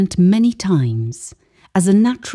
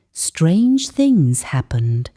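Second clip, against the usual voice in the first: plain reading voice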